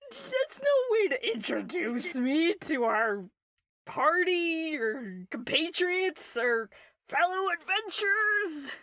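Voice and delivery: high, loud tipsy protest; drunk bard. Acted. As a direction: playfully object as a drunk bard.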